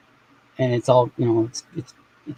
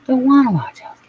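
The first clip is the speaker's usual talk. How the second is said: higher, whiny stress on wanna; whiny refusal; 11 semitones higher, faster than usual. Real, not acted. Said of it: "Not watching Hell's Kitchen".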